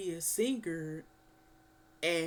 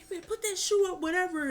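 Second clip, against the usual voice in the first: high, fast scolding father; hammed-up father warning; mock Joseph threat; 10 semitones higher, 4 dB louder, much faster than usual. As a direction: rattle off a dad's threat. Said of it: "he's like".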